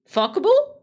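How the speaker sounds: shrill high squeak of a question; high squeal. Acted. Streamer joking about the AI copy, in a high squeal.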